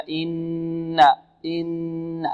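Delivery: إن with the doubled N held humming; full nasal N. Shown as a demonstration. Teacher showing students the full ghunna, N held long in إن. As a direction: hum long on the N of إن. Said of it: "النون مشددة غنة أكمل هكذا".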